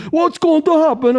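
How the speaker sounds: very high, squeaky imitation of a frightened friend; high-pitched panicky question; mock scared friend. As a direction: squeak it out in fear. Friend Johan voiced, frightened.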